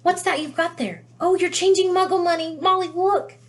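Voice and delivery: a fascinated wizard calling to his wife; excited. A narrator's reading. Wizard calling his wife over to see Muggle money, excited. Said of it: "He pointed excitedly".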